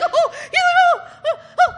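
very high, loud squeals; hammed-up overexcited "oh"s, shooting up high; so excited. Father so excited over his son, squealing high. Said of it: "he's so excited".

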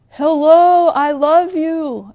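loud high call from a hilltop; dad yelling down. Retold or imitated. Dad calling down the mountain to his children, loud call. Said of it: "your dad's yelling down the mountain".

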